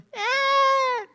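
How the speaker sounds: high, sudden imitated baby cry; mock baby wail. Preacher imitating a crying little girl with a high wail. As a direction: squeal it out like a wailing toddler. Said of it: "a guria começou".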